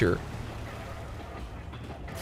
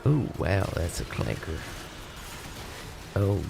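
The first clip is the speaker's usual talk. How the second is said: low mocking voice; 7 semitones lower, 13 dB quieter than usual.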